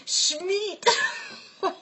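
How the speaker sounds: high, squeaky, breaking up with laughter; helpless giggling. Real, not acted. Apologizing for gallows humor, laughing helplessly. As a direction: squeeze the words out while cracking up. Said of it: "I can't help it".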